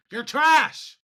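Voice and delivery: loud, high angry jab at Kyrie; frustrated yell. Real, not acted. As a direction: yell it at the player in real frustration. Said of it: "It's frustrating".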